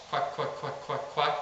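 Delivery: hushed, voiceless run of quacks; whispered quack. Shown as a demonstration. Coach demonstrating a simple feed call, whispered. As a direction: whisper the quacks in an even string. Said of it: "me whispering the word quack".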